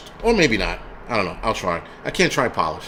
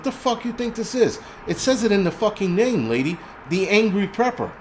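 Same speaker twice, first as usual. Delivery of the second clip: high-pitched, exasperated comeback; indignant retort; 6 semitones higher than usual. Retold or imitated. Streamer answers complaint about his cursing, indignant retort. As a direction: pitch it up as if stating the obvious.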